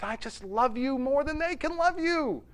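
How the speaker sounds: high-pitched, eager boast; full of gusto. Retold or imitated. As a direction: declare love with swaggering zeal. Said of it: "just gusto he could bring".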